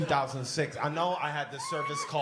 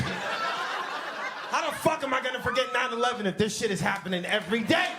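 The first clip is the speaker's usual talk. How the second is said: louder, rising comic outrage; exasperated rant; 7 semitones higher, 5 dB louder than usual. Acted. Fed up with plane crashes, a loud exasperated shout. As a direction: yell it in disbelief.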